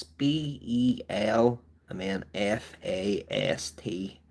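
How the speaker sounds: slow, letter-by-letter spelling of Belfast; spelled out slowly. Shown as a demonstration. Correcting a viewer who misheard, spelling Belfast slowly. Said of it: "Germany? No, no, no".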